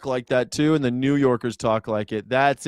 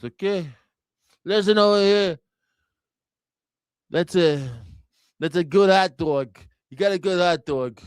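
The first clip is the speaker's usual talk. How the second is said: hammed-up old New Yorker voice, higher; 4 semitones higher than usual. Comic mimicking old New Yorkers over food, hammed-up. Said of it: "all old New Yorkers do that".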